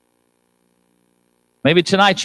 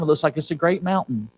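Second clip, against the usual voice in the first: normal talking voice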